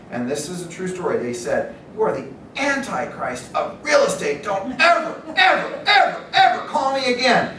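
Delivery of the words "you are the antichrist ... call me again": angry phone yell. Retold or imitated. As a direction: build from rant to screaming ever. Angry prospect yelling on phone.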